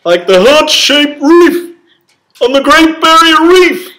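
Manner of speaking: very high, loud, slow hammed-up shout; stretched, squealing mock wonder; mock excited exclaim. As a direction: ham it up like a thrilled tour guide. Husband teasing, hammed exclamation.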